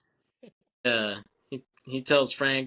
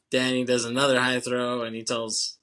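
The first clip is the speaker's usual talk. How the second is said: normal talking voice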